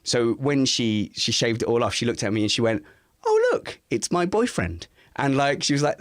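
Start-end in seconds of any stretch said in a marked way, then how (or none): 3.2-4.9 high, playful "Oh, look" from the wife; teasing wife. Retold or imitated. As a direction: tease him like a delighted partner. Wife teasing after shaving him.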